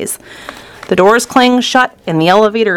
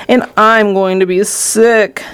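slow, drawn-out complaint of nausea; mild queasy yell; slower than usual. A narrator's reading. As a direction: drag it out as if about to be sick. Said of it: "yelled Missus T V".

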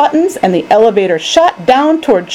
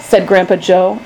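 usual speaking voice

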